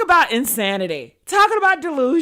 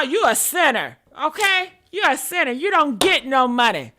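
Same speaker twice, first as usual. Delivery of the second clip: usual speaking voice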